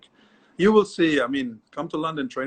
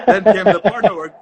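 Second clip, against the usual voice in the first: loud, high, helpless laughter through the words; real laughter bursting into speech; laughing while talking; 7 semitones higher, 13 dB louder than usual. Real, not acted. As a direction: crack up while telling the story. Laughing at his own anecdote.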